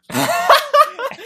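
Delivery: loud high cackle of laughter; real burst of laughter. Real, not acted. Friend laughing at the teacher story, loud cackle. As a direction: crack up loudly.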